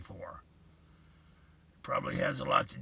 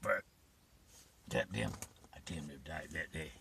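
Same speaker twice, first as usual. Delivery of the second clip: emotional; 4 semitones lower, 6 dB quieter than usual. Real, not acted. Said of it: "Sorry if I get a little emotional".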